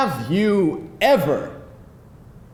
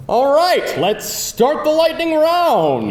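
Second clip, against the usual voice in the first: announcer hype; 7 semitones higher than usual. Acted. Host opening a quiz game.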